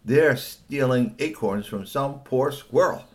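unhappy outrage. Acted. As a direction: work up from pity to loud, high exasperation. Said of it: "she wasn't very happy".

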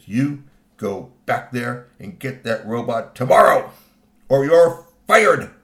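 slow, stern order building to a shout; furious boss laying down an ultimatum; angry. Acted. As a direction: spit out the threat slowly, blasting the ending. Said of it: "He was so angry".

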